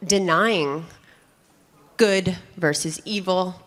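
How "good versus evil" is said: usual speaking voice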